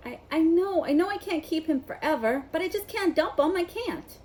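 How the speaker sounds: high, loud torn plea; anguished pleading. A narrator's reading. Hagrid refusing to give up Norbert, high and anguished. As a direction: beg them to understand.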